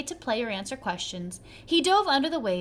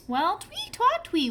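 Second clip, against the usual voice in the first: much higher whistle sound; whistled twee; 9 semitones higher than usual. A narrator's reading. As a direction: chirp the tune. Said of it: "whistled the boy".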